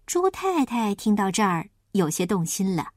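ordinary delivery, nothing marked

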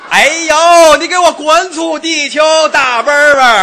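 high, loud, drawn-out mock-heroic shout; battle cry. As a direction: roar at the enemy car. Acting domestic cars battling a Benz. (夸张地高声拖长喊战斗口号)

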